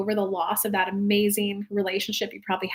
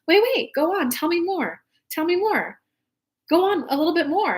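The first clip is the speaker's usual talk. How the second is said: higher-pitched, keen begging for more; eager urging; 6 semitones higher than usual. Retold or imitated. Listener urging a friend to keep talking about rockets, eagerly. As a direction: egg them on eagerly, pitched up.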